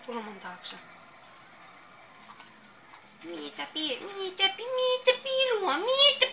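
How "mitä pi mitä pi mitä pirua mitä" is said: from puzzled to much higher and loud; building disbelief. Real, not acted. Gamer growing baffled, ending in a loud high yelp. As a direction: climb in pitch with each what the hell. Rising exasperation in game.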